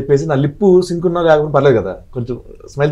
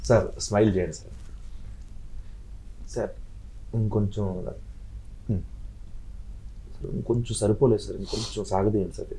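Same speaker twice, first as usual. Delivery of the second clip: quiet coaxing; 3 semitones lower, 14 dB quieter than usual. Retold or imitated. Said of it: "ఇలా ఉంటుంది".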